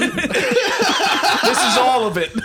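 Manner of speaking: high, slow, loud laughing words. Real, not acted. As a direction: squeal it through laughter. Cracking up at the picture.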